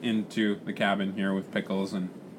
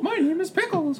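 exaggerated, very high cartoon mouse; Mickey Mouse. Repeating Pickles's introduction as a mock Mickey Mouse. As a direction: squeak it in a giggly falsetto. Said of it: "That sounded like Mickey Mouse".

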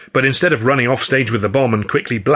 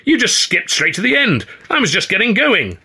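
high indignant rant; angry complaint; 10 semitones higher than usual. A narrator's reading. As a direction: berate them for cutting the scene. Ed angrily berating colleagues.

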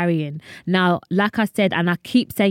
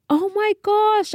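high, amazed oh my gosh; surprised squeal at unexpected birthday gifts; shocked gasp; 14 semitones higher than usual. Retold or imitated. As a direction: gasp it out like you just saw the gifts. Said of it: "And I was so shocked".

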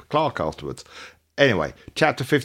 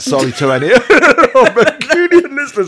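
laughing apology; 12 dB louder than usual. Real, not acted. Laughing at own bad accent.

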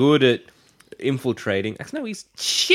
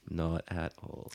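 lower, softer and slow; quiet deadpan; 13 semitones lower, 15 dB quieter, slower than usual. Shown as a demonstration. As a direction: drop it low and drag it. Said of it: "repeated Not at all".

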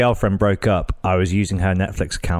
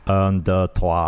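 even lower, sultrier French count; asked to repeat the French count, more sultry; sexier French; 4 semitones lower, much slower than usual. Shown as a demonstration. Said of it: "Do it again".

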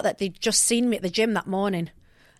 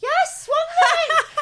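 much higher, slow voice thrown across the room; 3 dB louder, slower than usual. Retold or imitated. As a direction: sound far off across the room. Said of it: "throwing my voice to the other side of the room".

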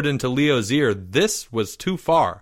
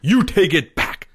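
raised, higher demand; angry yell; 7 semitones higher, 4 dB louder than usual. A narrator's reading. Boy yelling at friend in headlock, angry. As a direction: order him to retract it. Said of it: "he yelled".

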